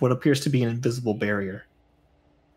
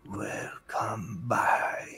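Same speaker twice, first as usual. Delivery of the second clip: eerie whisper; 7 dB quieter, much slower than usual. Acted. House shadow greets in darkness.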